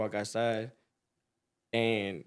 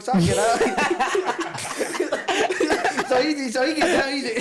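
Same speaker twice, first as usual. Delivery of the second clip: high, drawn out through laughter; can barely get it out for laughing; laughing hard; 12 semitones higher, 6 dB louder, slower than usual. Real, not acted. Laughing hard mid-story.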